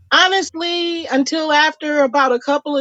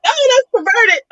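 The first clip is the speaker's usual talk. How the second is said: shocked disgust; 9 semitones higher than usual. Retold or imitated. She reacts to the flashing.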